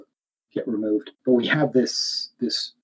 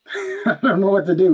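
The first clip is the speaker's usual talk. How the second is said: laughing; 8 semitones higher, 5 dB louder than usual. Real, not acted. Laughing helplessly at tech trouble.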